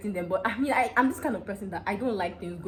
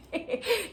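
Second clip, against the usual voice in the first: high laugh. Real, not acted. Laughing at own story.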